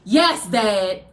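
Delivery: a sullen, grudging "Yes, Dad"; with attitude. Retold or imitated. Reluctant kid answering her dad, with a hint of attitude. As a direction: give in, but let the sulk show. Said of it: "With attitude".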